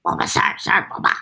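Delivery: whispered creature call; breathy little creature name cry; Bulbasaur voice. Acted. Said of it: "Bulbasaur in Pokemon".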